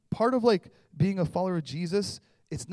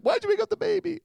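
high, loud mock complaint; hammed-up high whine; mock scold; 8 dB louder, faster than usual. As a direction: squeal it like an annoyed spouse. Imagined scolding voice.

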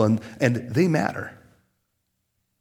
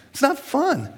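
whiny complaint; 11 semitones higher, slower than usual. Retold or imitated. Pastor whining about being sick.

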